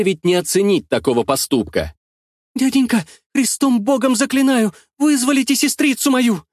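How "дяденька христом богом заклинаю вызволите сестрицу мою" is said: put-on high, trembling boy's plea; terrified pleading hostage. A narrator's reading. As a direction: beg in a shaking high child's voice. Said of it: "дрожащим голосом попросил меня мальчик". (дрожащий голос испуганного мальчика)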